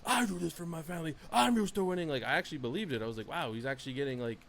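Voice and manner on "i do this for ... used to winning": imitated fired-up outburst, high and rising; high-pitched impassioned mimicry; passionate Roman Reigns. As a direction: act out a wrestler getting worked up, louder as it goes. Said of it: "getting, like, passionate and he was going like".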